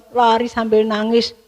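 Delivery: ordinary delivery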